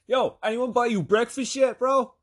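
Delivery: high, loud imitation of a brash buddy; loud showy offer. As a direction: holler it for everyone to hear.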